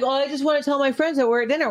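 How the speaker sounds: put-on high whiny kid voice; whiny teen. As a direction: plead like a teenager. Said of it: "He's like".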